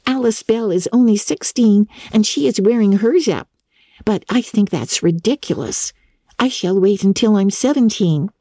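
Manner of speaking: put-on young Diana chatting brightly; lightly voiced young girl.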